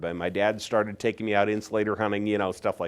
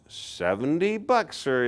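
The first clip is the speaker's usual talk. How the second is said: much higher, slow mock disbelief; incredulous family member; 7 semitones higher, slower than usual. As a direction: drag it out like they think he's lost it. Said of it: "And they're like".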